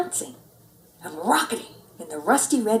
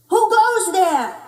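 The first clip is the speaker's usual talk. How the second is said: much higher, louder and slow call; hollering; 10 semitones higher, 7 dB louder, slower than usual. A narrator's reading. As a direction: call out into space. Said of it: "hollered Skipito".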